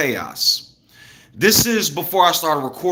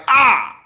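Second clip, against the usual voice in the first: mock cry; 9 semitones higher, 5 dB louder than usual. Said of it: "normally somebody be like".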